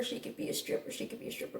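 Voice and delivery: repeating it in a whisper. Retold or imitated. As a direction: breathe the words out, almost soundless. Repeats his remark, dropping voice.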